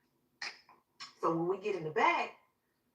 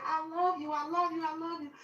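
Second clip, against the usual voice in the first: high, pleading goodbye; retold emotional last plea; distraught farewell; 9 semitones higher than usual. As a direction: beg them lovingly, pitched way up. Tearful farewell to family.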